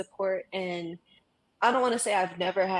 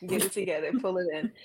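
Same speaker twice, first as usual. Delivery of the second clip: holding back tears; 3 dB quieter than usual. Real, not acted. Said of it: "I'm trying not to cry".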